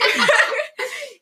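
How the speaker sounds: laughing hard; a high burst of laughter. Real, not acted. Laughs at own story.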